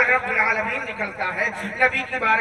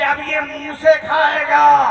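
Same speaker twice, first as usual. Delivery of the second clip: louder, ringing proclamation; voice from above; 3 semitones higher, 7 dB louder than usual. Acted. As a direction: announce it as a divine answer. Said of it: "تو آواز آئے گی کہ". (بلند آواز میں اعلان)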